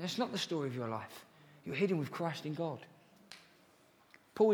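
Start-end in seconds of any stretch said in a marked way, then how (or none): none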